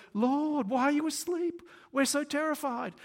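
high, loud mock-panicked plea; terrified, acted. Disciples waking Jesus in the storm, acted high panic. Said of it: "And they're terrified".

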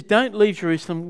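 ordinary delivery

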